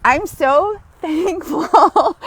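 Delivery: slow, loud, laughing thanks. Real, not acted. Thanking viewers for accepting her, laughing thanks. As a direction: laugh through the gratitude.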